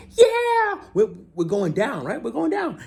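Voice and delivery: high, hyped-up shout of a thrilled skydiver; excited instructor. Retold or imitated. Tandem instructor cheering the first-timer while falling, in a high excited voice. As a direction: mimic the stoked older jumper cheering on the way down. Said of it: "he's all excited".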